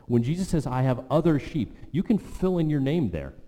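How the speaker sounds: full-force preaching roll call. Acted. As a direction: fire up the congregation. Preaches names into the verse.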